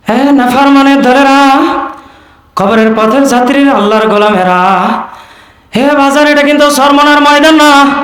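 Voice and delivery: high, chanted waz delivery; preacher impression. Shown as a demonstration. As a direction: imitate a preacher calling out in a ringing chant. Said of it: "কণ্ঠটা কার?".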